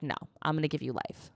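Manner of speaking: soft, quick benevolent voice; gentle mock god. Eru granting the dwarves life, gently. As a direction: grant it kindly and lightly. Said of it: "was like".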